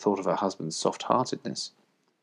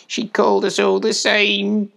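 high, slow, unhappy piglet; trembling voice; 13 semitones higher, 6 dB louder, slower than usual. A narrator's reading. Piglet remembering his mother, voice trembling. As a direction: answer high and slow, near to tears. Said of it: "his voice trembled as he answered".

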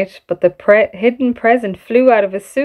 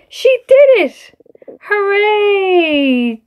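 crowd cheering; 12 semitones higher, slower than usual. Acted. Crowd cheering Amelie's lift, high and joyful. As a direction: shout it like a delighted crowd. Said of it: "cheered the crowd".

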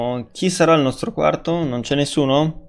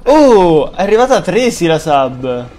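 loud, higher surprised reaction; amazed; 4 semitones higher, 5 dB louder than usual. Real, not acted. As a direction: react in disbelief to another gift. Another sub arrives unexpectedly.

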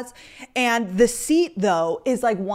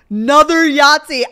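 loud, raised "Another Yahtzee"; excited announcement; 6 semitones higher, 14 dB louder than usual. Real, not acted. Mom announcing the son's second Yahtzee, loud. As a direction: call it out in disbelief.

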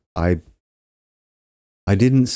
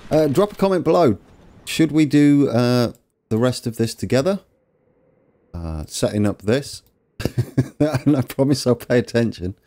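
normal talking voice

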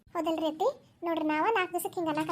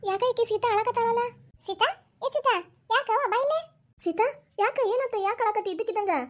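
high, raised voice of alarmed concern; worried, high-pitched summons; concerned calling out; 6 semitones higher than usual. Acted. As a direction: call her over, pitched up high with worry. Concerned elder calls crying Sita.

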